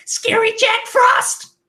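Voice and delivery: very high, slow excited cry; drawn-out high-pitched dramatic narrator's exclamation; Michael Anglis impression. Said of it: "Michael Anglis is like".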